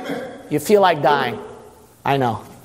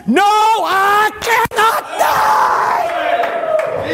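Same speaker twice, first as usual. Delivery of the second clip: very high, slow, drawn-out shout; triumphant scream; much slower than usual. Acted. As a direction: scream defiance with every word stretched. Climax of sermon.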